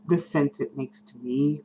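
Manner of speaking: ordinary delivery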